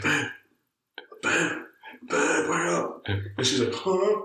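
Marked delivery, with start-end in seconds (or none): 0.0-3.0 higher-pitched wake-up call, lightly acted; waking a partner. Speaker to sleeping partner at midnight, retold waking call. 3.7-4.2 high, confused little grunt; just-woken partner. Retold or imitated. Woken partner answers the speaker, retold sleepy confusion.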